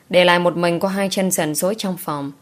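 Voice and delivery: usual speaking voice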